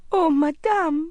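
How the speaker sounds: put-on high, drawn-out boy's cry of dismay; astonished young boy's exclamation; shocked cry. A narrator's reading. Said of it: "he cried in a shocked voice".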